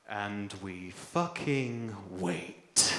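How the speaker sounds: ordinary talk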